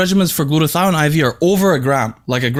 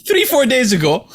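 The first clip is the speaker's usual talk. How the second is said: usual speaking voice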